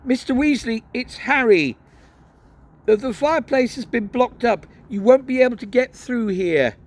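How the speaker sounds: lightly voiced boy. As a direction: tell him the news through the boards. Boy explains through blocked fireplace.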